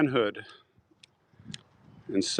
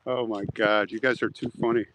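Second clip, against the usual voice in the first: usual speaking voice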